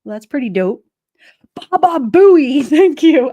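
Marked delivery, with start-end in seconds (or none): 1.5-3.3 slow, loud, higher Baba Booey shout; remembering the name, shouts it out; triumphant shout. Real, not acted. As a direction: belt out Baba Booey with each syllable drawn out. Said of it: "I couldn't think of his name".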